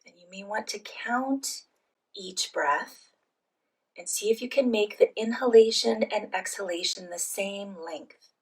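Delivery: soft, unhurried phrases with long pauses; guided meditation. Real, not acted. Leads guided breathing meditation.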